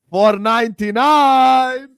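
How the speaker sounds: loud, high, drawn-out announcement; slow, booming hype call; excited countdown cry. Real, not acted. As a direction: stretch out the number in a rising shout. Count nearly reaches five hundred.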